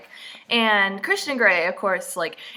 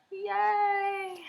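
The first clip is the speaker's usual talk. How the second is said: thin, high, soft drawn-out yay; half-hearted cheer; 13 semitones higher, 6 dB quieter than usual. Real, not acted. Reader with a migraine greets promised chapter, half-hearted cheer. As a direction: cheer weakly, high and quiet.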